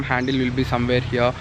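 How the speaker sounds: ordinary talk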